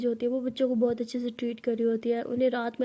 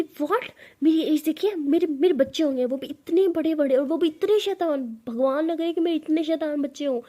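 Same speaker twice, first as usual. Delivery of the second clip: higher, louder indignant shriek; shrieking outrage; 4 semitones higher, 5 dB louder than usual. Acted. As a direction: scream it in outrage. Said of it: "परी ऐसे चीखने लगते हैं". (गुस्से में चीखती परी)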